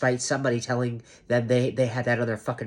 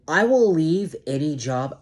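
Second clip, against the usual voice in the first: emphatic; 6 semitones higher, 6 dB louder than usual. Real, not acted. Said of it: "yes, I am getting emphatic".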